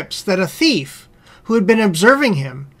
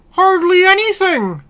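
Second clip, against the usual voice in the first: higher-pitched persuasive dog voice; well-fed house dog; 10 semitones higher than usual. Acted. House dog advising the hungry wolf, higher persuasive voice. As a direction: coax in a raised character voice.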